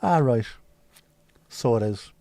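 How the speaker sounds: punchy Irish "ah, right"; Irish accent. Acted. Streamer demonstrating a verbal habit, Irish accent. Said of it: "gotta use an Irish accent".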